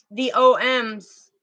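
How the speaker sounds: ordinary talk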